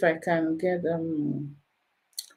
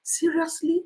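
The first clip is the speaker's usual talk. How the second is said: incredulous; 6 semitones higher, 5 dB quieter than usual. Retold or imitated. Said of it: "I said".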